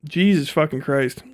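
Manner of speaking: ordinary talk, nothing marked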